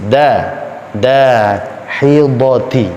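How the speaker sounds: DAHIDATI starting light, "dæ"; thin da. Shown as a demonstration. Teacher correcting the thick reading, thin Dahidati. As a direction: draw out Dahidati with a thin first vowel.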